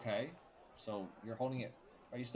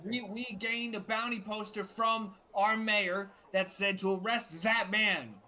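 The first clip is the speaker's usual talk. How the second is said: slow, higher guard voice; 9 semitones higher, 4 dB louder, slower than usual. Acted. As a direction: explain like a dutiful guard.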